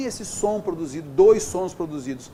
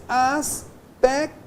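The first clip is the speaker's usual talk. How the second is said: "aspecto" broken slowly as "as... pec... to"; teacher syllabifying "aspecto" deliberately; syllables split apart; 4 semitones higher, much slower than usual. Shown as a demonstration. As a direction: leave gaps between the syllables of "aspecto". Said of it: "ficam em sílabas diferentes".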